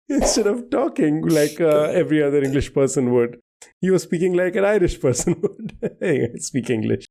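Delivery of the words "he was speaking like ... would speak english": amused, laughter breaking into the words; laughing. Real, not acted. Quizmaster delighting in the Irish tutor twist, laughing. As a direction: talk through a laugh, higher voice.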